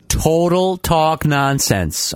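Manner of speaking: very slow, drawn out; slowed-down echo. Retold or imitated. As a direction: stretch each word slowly. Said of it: "I slowed it down".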